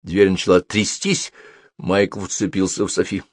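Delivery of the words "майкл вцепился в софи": ordinary delivery, nothing marked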